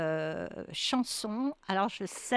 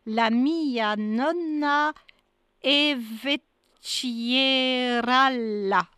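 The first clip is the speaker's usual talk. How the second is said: slow, careful Italian accent, a touch louder; 4 dB louder, much slower than usual. Shown as a demonstration. Radio host reads an Italian song title to the guest, in a slow halting Italian accent. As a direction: sound out the Italian words slowly like a learner. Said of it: "pardon pour le, l'accent italien que je ne parle pas".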